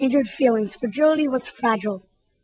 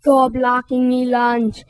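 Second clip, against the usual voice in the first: lumbering brute pushing someone aside; bully bellowing; slower than usual. Acted. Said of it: "Rudy bellowed".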